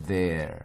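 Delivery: "there" with a voiced th; isolated "there", tongue-between-teeth th. Shown as a demonstration. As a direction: demonstrate the buzzing th in "there". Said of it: "이렇게 발음하는 것들은 요렇게 발음이".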